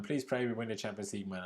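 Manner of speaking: usual speaking voice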